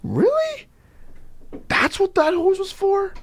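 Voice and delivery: very high, astonished question; amazed. Retold or imitated. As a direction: gasp it out in wonder. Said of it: "They're like".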